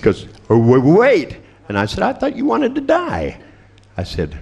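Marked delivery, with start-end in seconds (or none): 0.5-1.4 loud stammered plea to hold on; mock panic. Suicidal patient faced with a knife, mock panicked stammer. 2.0-3.4 very high put-on innocent voice; mock puzzled taunt. Therapist to patient who suddenly wants to wait, high mock puzzlement.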